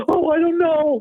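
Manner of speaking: high, flustered squeak; nervous guy voice. Retold or imitated. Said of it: "Guys always macho like".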